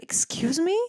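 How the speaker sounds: offended excuse me. Retold or imitated. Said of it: "I'm like".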